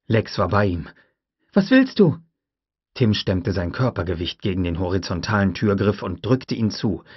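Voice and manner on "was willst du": put-on high, loud girl's cry; frightened shout. A narrator's reading. Lex answering Tim at the door, frightened shout. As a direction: call back in fear.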